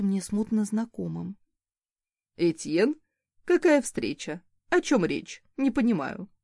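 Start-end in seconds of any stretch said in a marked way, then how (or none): 2.4-6.3 wry smirk. A narrator's reading. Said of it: "с кривой ухмылкой".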